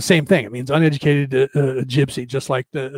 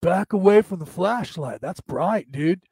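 drawn-out West Coast dude accent; hammed-up L A dude accent; Californian accent; much slower than usual. Shown as a demonstration. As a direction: put on a California bro accent. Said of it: "If I talk like this".

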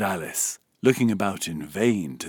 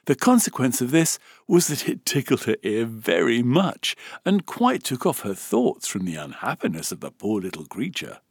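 usual speaking voice

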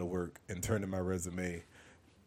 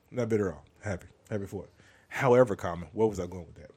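normal talking voice

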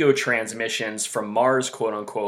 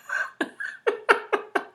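laughing. Real, not acted. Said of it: "I can't even say it without laughing".

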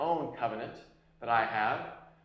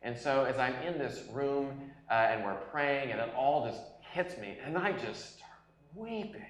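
ordinary talk

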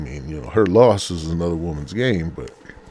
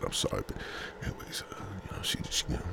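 whispered aside, trailing off; hushed so as not to be overheard; very soft, breathy mumble; keeping quiet; 14 dB quieter, much faster than usual. Pretend. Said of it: "I gotta be quiet now".

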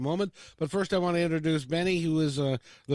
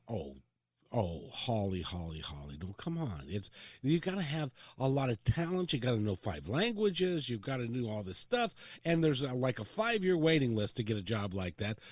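a hint of a softer, lower discouraging adult; 5 semitones lower, 4 dB quieter than usual. Grown-up telling young Holly her dream is unrealistic. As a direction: talk her down gently from her dream. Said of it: "She was then told".

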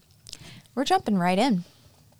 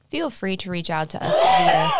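loud burst; 4 semitones higher, 6 dB louder than usual. Real, not acted. Sudden loud burst, coughing.

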